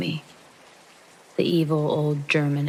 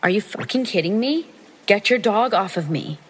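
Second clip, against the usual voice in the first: higher, louder retold yell; exasperated retold shout, pitched up; retold angry exclaim; 5 semitones higher, 3 dB louder than usual. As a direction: demand he call off the dog. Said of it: "Again, I exclaim".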